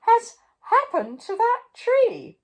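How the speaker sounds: high, loud shocked cry; king shocked by the green tree; astonished cry. A narrator's reading. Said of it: "he cried".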